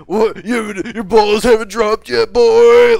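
high, very loud, slow mocking jeer; mocking grown man. Grown man on Xbox Live jeering at a kid, loud and slow. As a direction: stretch it out like a bully taunting a boy. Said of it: "grown men just use that, like, classic".

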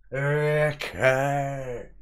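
big high call of okay; loud drawn-out okay. Real, not acted. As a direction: boom it out to greet the chat. Loud greeting to a chat viewer.

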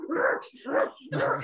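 high straining rrr noises, mock effort; effortful growl. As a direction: grunt as if dragging skates. Pulling a skater's skates.

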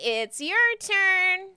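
slow, high, drawn-out call; pixie calling out. Acted. Pixie summons the next newcomer, high and drawn-out. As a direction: sing it out high, stretching the words.

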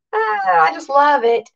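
ordinary talk

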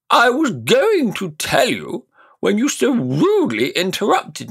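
high, loud, self-important magician; pompous old uncle. Acted. Uncle lecturing the nephew, high and pompous. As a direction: puff yourself up as the great scholar.

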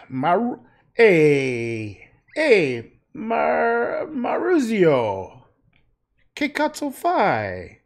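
loud, high, drawn-out Italian shouting; mock Italian. Painter hollers at an imagined Maurizio, mock Italian accent. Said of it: "they don't really talk like that".

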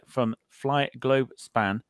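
slow, deliberate word-by-word 'Fly ... Globe ... Span'; careful, broken up. Real, not acted. Host retrying a tricky airline name after fumbling it, slow and careful. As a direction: pick through the tongue-twister one word at a time. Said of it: "try saying that after a few Westerns".

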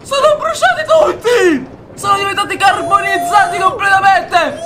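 high-pitched shouted commentary; excited alarm. Real, not acted. Streamer watching everyone get burnt, high excited shout. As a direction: cry out in thrilled alarm, pitch way up. Shouts as mobs burn in game.

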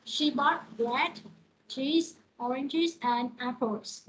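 slow list, bread and cheese rising, apples falling; list intonation. Shown as a demonstration. Teacher demonstrating a list to students, slow rise-rise-fall. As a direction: read it slowly, each item up, the last down. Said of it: "cái điều nó sẽ đi lên hết, cái từ cuối cùng nó sẽ xuống".

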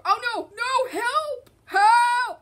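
high, rising cries for help; playful panic. Pretend. Player attacked by Tiger, high panicked cries for help.

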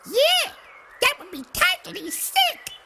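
put-on teen girl voice. Hoodie, teen slang voice.

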